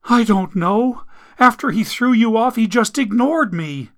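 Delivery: high, anxious voice; frightened girlfriend explaining herself; flustered girlfriend. Acted. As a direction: answer nervously. Amelia answers timidly.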